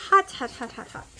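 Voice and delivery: loud quick yelps of pain; yelping hot. Real, not acted. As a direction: yelp it as your fingers burn. Burning fingers on hot food.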